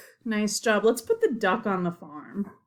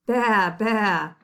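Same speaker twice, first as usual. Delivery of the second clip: sheep baa; 4 dB louder than usual. Shown as a demonstration. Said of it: "This animal says".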